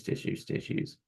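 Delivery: breathy whisper, dropping to almost nothing; whispered aside. Acted. Acupuncturist fussing over her box of tissues, whispering. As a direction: hush as if sharing a secret with herself.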